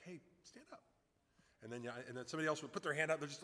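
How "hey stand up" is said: quietly coax, almost under the breath; hushed, discreet. Retold or imitated. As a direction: keep it between the two of you. Retells calming the man down.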